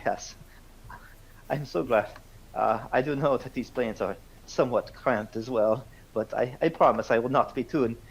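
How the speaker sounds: proper Austrian colonel, pitched slightly up; courteous Austrian-accented officer asking a favor. Acted. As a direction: speak halting English with an Austrian accent. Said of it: "an Austrian that speaks very poor English".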